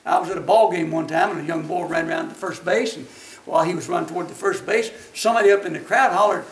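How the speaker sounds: usual speaking voice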